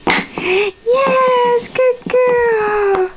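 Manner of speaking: very high, drawn-out cheer; thrilled praise. Real, not acted. Cheers toddler's correct answer.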